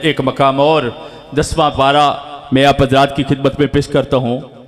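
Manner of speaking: normal talking voice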